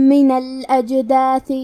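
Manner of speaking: slow من الاجداث, the two meems merged into one long nasal meem. Shown as a demonstration. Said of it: "میم غنہ اور تشدید کے ساتھ پڑھیں گے". (میم غنہ اور تشدید کے ساتھ)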